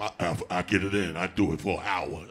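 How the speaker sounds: low, lazy boast; lightly voiced braggart; bragging dude. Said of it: "you hear 'em".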